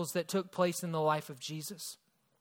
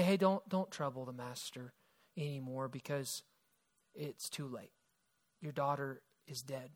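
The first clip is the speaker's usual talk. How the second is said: much softer and lower, breaking sad news; hushed bad news; 5 semitones lower, 8 dB quieter than usual. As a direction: let the voice sink as you tell him. Messengers bring news of death.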